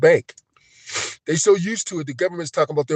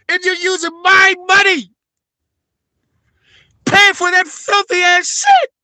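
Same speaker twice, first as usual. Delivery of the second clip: much higher and louder, yelling in real outrage; furious shouting; 3 dB louder than usual. Real, not acted. Ranting at the government over his money, furious real shouting. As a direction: shout it out, truly furious about paying for it.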